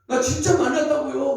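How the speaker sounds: imitating an angry insistence, high and loud; mock a loud, heated protest; indignant outburst. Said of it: "막 그러고 승질을 내고 그래요".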